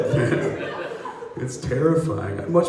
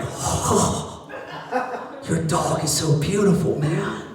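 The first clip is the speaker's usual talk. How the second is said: higher, dreamy stoner gushing; mellow stoner; 6 semitones higher, slower than usual. Retold or imitated. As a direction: coo over the dog like a stoned guy. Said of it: "He's like".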